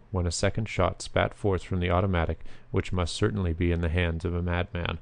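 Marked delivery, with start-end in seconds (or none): none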